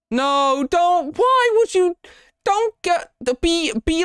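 very high, frantic pleading; panicked squeal. Real, not acted. Panicking in horror game.

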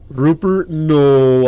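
shocked shout. Real, not acted. Finds his friend dead.

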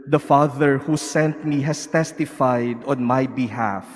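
usual speaking voice

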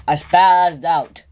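slow, loud, stressed spazzed; drawn-out insistence; emphatic. Real, not acted. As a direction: lean hard on spazzed. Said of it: "No, really, I did".

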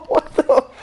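high wheezing laugh; losing it laughing. Real, not acted. Friend laughing hard.

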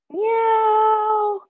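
high, loud little meow; cat meow. Shown as a demonstration. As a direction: mew like a tiny cat. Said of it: "And a cat".

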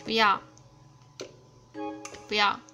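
normal talking voice

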